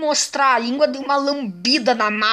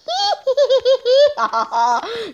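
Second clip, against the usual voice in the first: high gleeful cackle; 11 semitones higher, 3 dB louder than usual. Real, not acted. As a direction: laugh like a gleeful hunter. Gleeful laugh while hunting.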